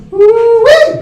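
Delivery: very high, loud whoop. Retold or imitated. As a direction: let out a big whoop. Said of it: "And you go".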